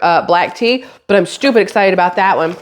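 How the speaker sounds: normal talking voice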